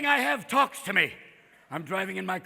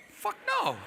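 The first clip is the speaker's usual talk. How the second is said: high-pitched indignant refusal; indignant caller; 10 semitones higher, 3 dB quieter than usual. Acted. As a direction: voice jumps up in disbelief. Caller rejects the machine's mishearing.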